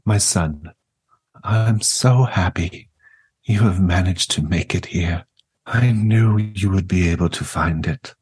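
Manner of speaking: lightly voiced gentle old grandmother; warm serene goddess voice. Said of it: "She says".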